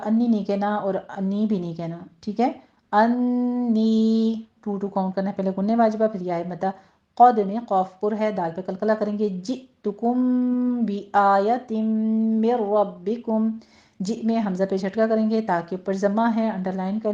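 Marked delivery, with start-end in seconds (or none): 2.9-4.3 انی with both sounds held their full time, drawn out; held vowels. Shown as a demonstration. Teacher showing students the full length, drawn-out. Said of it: "دونوں کو پورا پورا time دیجیے گا". 9.3-13.5 slow melodic chanted recitation; Quran recitation. Shown as a demonstration. Teacher reciting the verse for students, slow chant.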